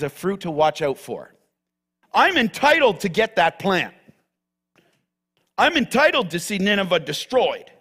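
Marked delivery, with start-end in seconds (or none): 2.1-7.7 entitled voice. Acted. Voicing entitled heart.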